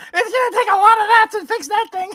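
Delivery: very high, fast, cackling creature voice; Salacious Crumb. Acted. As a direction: jeer like a squealing little imp. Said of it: "It's like Salacious Crumb".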